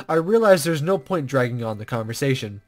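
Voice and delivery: ordinary talk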